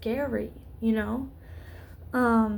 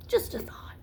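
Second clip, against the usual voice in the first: breathy throwaway whisper, pitched up; whispered aside; 7 semitones higher, 4 dB quieter than usual. Real, not acted. Vlogger whispering an afterthought to viewers.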